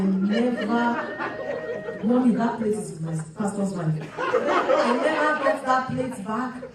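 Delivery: slow, laughing through the words; fond laugh over a lost plate. Real, not acted. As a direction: chuckle as you remember. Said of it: "laughing recalling the plate".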